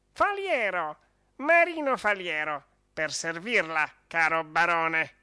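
lightly voiced proud little dog; little dog introducing himself. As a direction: present yourself with a small bow.

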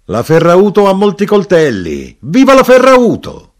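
loud, high-pitched rowdy cheer; shouted cry. A narrator's reading. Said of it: "E l'arrotino gridò".

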